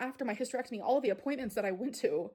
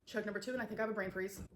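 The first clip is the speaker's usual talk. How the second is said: usual speaking voice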